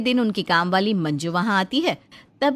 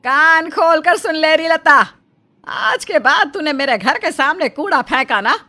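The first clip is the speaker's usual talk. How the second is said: गुस्से में झगड़ा; 5 semitones higher, 6 dB louder than usual. Acted. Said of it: "लता से लड़ने लगती है".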